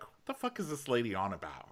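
muttered aside. Retold or imitated. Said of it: "she's like".

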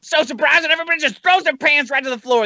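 high, silly put-on voice; mock surprised voice. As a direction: pitch it up like a giddy caricature. Continuing mock voice bit.

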